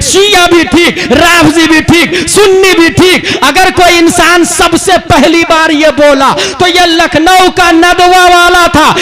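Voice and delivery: loud, forceful public sermon; full-force preaching. Real, not acted. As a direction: thunder it out to the crowd, loud throughout. (पूरे ज़ोर से बुलंद तक़रीर)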